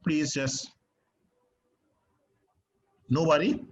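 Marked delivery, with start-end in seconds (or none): none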